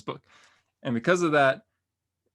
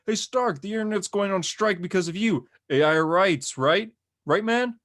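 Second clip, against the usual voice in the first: high-pitched, louder pestering voice; heckling passer-by; 8 semitones higher, 4 dB louder than usual. Retold or imitated. Man with a phone hassling Tony Stark, heckling. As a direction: goad him like a pushy amateur interviewer, pitched up. Guy filming confronts Tony in street.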